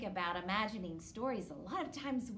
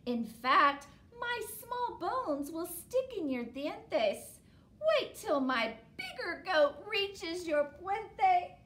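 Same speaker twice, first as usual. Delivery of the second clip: little goat; 5 semitones higher, 7 dB louder than usual. Acted. Little goat answers the troll.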